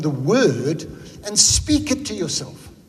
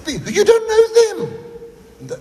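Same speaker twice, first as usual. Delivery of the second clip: laughing; much slower than usual. Real, not acted. Laughing mock disbelief.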